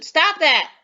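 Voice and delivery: loud, high urgent command; with emotion. Shown as a demonstration. Teacher showing an exclamation to pupils, emotional shout. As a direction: call out sharply to stop someone. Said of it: "say it with some emotion".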